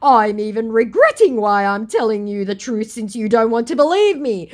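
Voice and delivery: hammed-up scammer plea; whiny scammer pleading. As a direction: beg to be believed in mock desperation. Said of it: "he goes".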